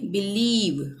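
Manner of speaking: बिलीव with a long stretched ई; careful model बिलीव, ई held long; drawn-out ee. Shown as a demonstration. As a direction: stretch the second vowel of बिलीव. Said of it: "हम आईई को हम खींचकर बोलेंगे".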